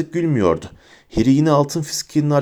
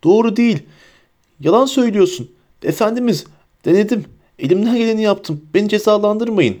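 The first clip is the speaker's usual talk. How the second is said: much higher, panicked pleading; desperate pleading; 10 semitones higher, 3 dB louder than usual. A narrator's reading. Bellatrix begs her master not to punish her, desperate.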